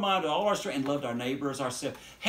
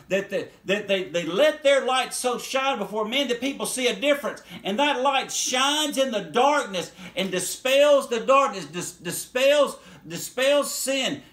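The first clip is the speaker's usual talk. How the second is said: raised, driving pulpit voice; fiery preaching; 4 semitones higher, 4 dB louder than usual. Acted. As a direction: preach it hard to the congregation. Preaching at full force.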